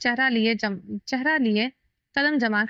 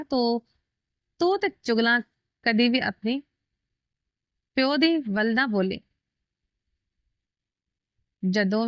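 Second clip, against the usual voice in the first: put-on Punjabi accent; slower than usual. A narrator's reading. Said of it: "Punjabi अंदाज में बोल पड़ा".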